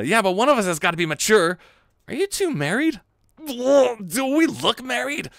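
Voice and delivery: high, loud exasperation; bickering partner. Acted. Partners quizzed about being married, exasperated. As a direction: bicker high, stretching out the retort.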